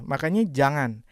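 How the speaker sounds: ordinary delivery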